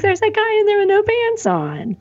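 a hint of a startled whisper-shout; alarmed retelling. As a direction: blurt it to the friends in mock shock. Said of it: "I'm like".

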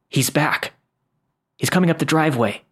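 rising, fast alarmed warning; urgent alarm. Acted. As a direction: warn them, the threat is close.

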